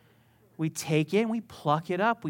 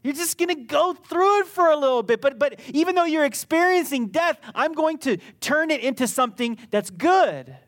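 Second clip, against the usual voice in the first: much higher and louder; God's reassuring voice; 9 semitones higher, 7 dB louder than usual. Acted. Pastor voicing God comforting through death, high and strong. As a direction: lift it up like a comforting promise. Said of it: "He says".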